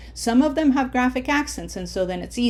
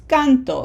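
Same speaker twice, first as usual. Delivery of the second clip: CANto, first syllable raised; stress on first syllable; 4 semitones higher, 6 dB louder than usual. Shown as a demonstration. Teacher confirms this is canto, stressed on CAN. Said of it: "Emphasis is on the red syllable".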